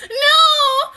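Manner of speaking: a high, loud, shocked no; shocked cry. Real, not acted. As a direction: cry out in dismay. Reacting to the show.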